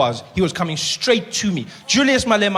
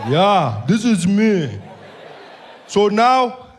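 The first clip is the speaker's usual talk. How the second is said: menacing slow greeting, weighing each word; Malema voice; 4 semitones higher, 6 dB louder, much slower than usual. Retold or imitated. Malema greets the comedian at his table, slow and menacing.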